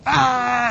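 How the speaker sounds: high-pitched pretend crying wail; mock wail. Student refused a grade change, imitated high wail. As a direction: burst into a sudden high fake bawl.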